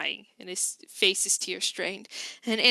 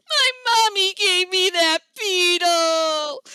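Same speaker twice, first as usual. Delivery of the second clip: put-on tearful child; 8 semitones higher, 7 dB louder, slower than usual. Crying child voice.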